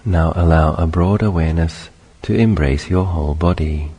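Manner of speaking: measured meditation guidance in short phrases; unhurried meditation teacher, spacious phrasing; guided meditation. Acted. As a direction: guide them gently through it, long pauses between phrases.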